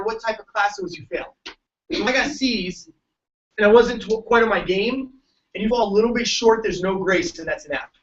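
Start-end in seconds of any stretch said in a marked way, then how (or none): none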